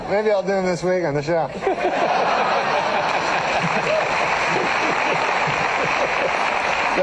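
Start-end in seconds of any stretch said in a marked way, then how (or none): 1.5-6.9 airy high-pitched laugh; high breathy laugh. Real, not acted. Storyteller cracking up at his own tale, high laugh. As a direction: let out a high wheezing laugh.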